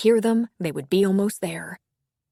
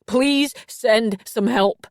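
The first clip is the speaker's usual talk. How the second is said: slow, deliberate plea, held steady; pleading to the dispatcher, trying to stay composed, slow; forced calm; 5 semitones higher, 8 dB louder, much slower than usual. Acted. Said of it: "he forced himself to calm down".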